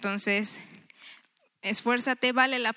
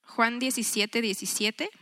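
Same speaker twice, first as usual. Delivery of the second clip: normal talking voice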